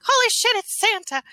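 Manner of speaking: high-pitched tiny astonishment; startled gingerbread men. Acted. Gingerbread men realizing it is Santa, high startled voice. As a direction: squeal it like a stunned little creature. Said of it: "And they're like".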